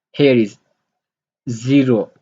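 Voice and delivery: normal talking voice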